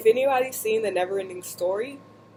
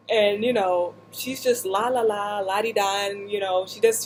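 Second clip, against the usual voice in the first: usual speaking voice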